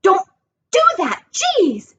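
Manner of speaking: high, jolted outburst; rattled high-pitched scolding; startled protest. A narrator's reading. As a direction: blurt it high after a fright. Startled boy scolds his friend.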